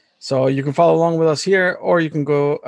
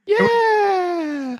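very high cheering yell; excited shout. Real, not acted. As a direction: whoop it out with excitement. Cheering before the wrestling.

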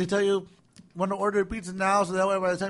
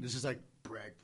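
whisper; 7 semitones lower, 15 dB quieter than usual. Real, not acted. Whispered brisket praise.

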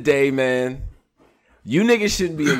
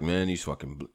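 lightly imitated low voice; Mike Polisi; 7 semitones lower, 9 dB quieter than usual. Host reading out a battler's complaint, lightly imitated low voice. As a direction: drop low, lightly mimicking him. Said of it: "I read it in his voice".

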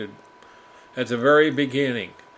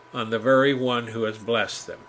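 normal talking voice

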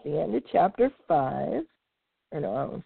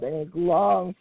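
plain reading voice

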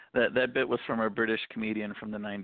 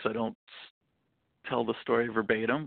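usual speaking voice

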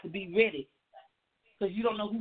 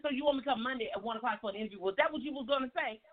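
rushed eager question; 4 dB quieter, faster than usual. Retold or imitated.

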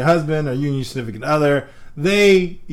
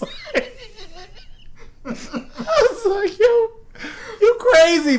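high, squeaky real laughter through the words; cracking up; much slower than usual. Real, not acted. Son reacting to his mom's crazy idea, cracking up. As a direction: barely get it out for laughing.

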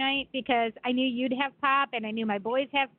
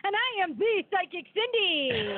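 slow, higher showy self-introduction; announcer voice; 6 semitones higher, slower than usual. Acted. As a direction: present yourself like a stage psychic. Co-host introduces herself on air.

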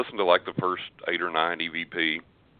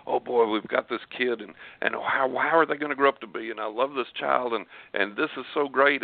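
higher-pitched, lightly voiced proud parent; imagined doting parents; 6 semitones higher than usual. Imagined parents delighting in their new child, lightly voiced excitement. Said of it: "think about his parents".

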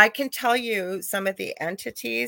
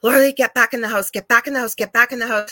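raised mock yell, fast, urgent; retold yelling; 4 semitones higher, 8 dB louder, faster than usual. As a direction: urge her back inside, a bit louder and quicker. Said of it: "my friend's yelling at me".

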